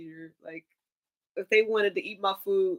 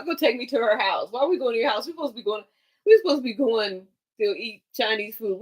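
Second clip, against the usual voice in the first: usual speaking voice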